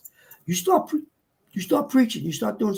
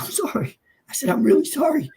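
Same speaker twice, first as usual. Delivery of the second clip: retold choked-up apology, pitched up; 7 semitones higher than usual. Apologising to the engineering director about his mother, retold choked up. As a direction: apologise as if overcome, lightly acted. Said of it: "I start getting choked up".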